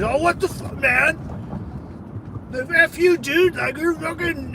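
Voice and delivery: high mock outburst from a drunk; imitating angry drunk. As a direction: play the drunk guy snapping. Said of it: "he just snapped".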